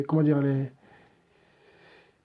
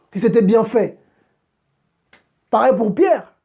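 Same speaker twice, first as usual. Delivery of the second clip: higher and louder, preaching at full force; fiery preaching; 5 semitones higher, 3 dB louder than usual. Acted. Preacher rebuking believers who keep everything for themselves, fiery preaching. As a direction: rouse the congregation with conviction.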